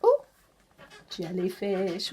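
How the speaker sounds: ordinary delivery, nothing marked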